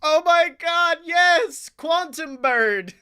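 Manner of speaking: ordinary delivery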